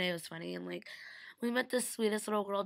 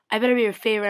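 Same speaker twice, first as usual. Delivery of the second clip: louder, playful demand to be the favorite; teasing loud quote; 3 semitones higher, 15 dB louder, much faster than usual. Retold or imitated. Friend hugging her, loudly demanding to be favorite. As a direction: call it out like a cheeky bid for top spot. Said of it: "he's like".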